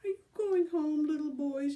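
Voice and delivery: loud high taunting yell; mocking shouted taunt. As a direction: call it across the field. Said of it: "yelled Billy".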